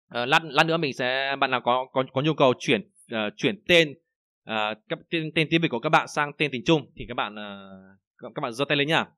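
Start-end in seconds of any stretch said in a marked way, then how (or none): none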